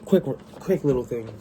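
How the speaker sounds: normal talking voice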